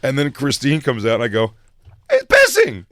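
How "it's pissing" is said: shrieked way up high and loud; loud high squeal. Retold or imitated. Telling Christine about the pisser, loud high squeal. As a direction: squeal it in a panicked falsetto. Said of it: "I say it so loud, like, so high pitched".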